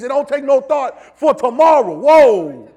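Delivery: loud, slow, high climax; preaching shout. Real, not acted. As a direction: build to a shouted peak.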